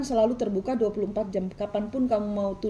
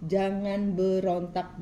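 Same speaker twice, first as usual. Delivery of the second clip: jangan berontak stretched out very slowly; slowed-down plea not to resist; slow emphatic repeat; much slower than usual. Acted. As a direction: drag each word of jangan berontak out, pleading.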